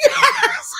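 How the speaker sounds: shrieks yes loud and high; triumphant yell. Real, not acted. Mother hearing the guilty verdict, ecstatic shout. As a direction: let it burst out, overjoyed. Second guilty count cheered.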